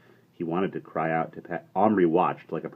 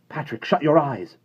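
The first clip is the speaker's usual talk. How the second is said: high, loud call to Patrick; warning shout; 10 semitones higher, 7 dB louder than usual. A narrator's reading. Omri yelling to Patrick before the shot. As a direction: yell a sudden warning.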